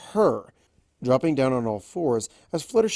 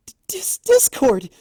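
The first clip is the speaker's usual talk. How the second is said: flustered outcry; 7 dB louder than usual. Acted. Flustered outburst at teasing.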